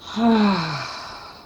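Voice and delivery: long audible sigh letting all the air out; great big sigh. Shown as a demonstration. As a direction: drop everything in one big exhale sigh. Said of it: "Let it out with a great big sigh".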